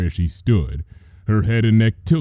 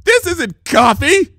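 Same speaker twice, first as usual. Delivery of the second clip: high, loud outraged yell; slow, furious high-pitched accusation; shrieking accusation over bad coffee; accusing shout; 6 dB louder, slower than usual. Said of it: "she accused".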